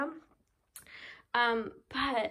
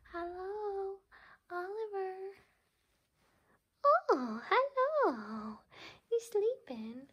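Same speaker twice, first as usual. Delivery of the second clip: kitten voice; 10 semitones higher, slower than usual. Real, not acted. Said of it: "Please excuse my kitten voice".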